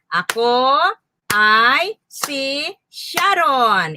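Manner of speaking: "Ako ay si Sharon" very slow, gap after each word. Shown as a demonstration. Teacher counting words with learners, "Ako ay si Sharon" slowly separated. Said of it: "Bilangin nga natin".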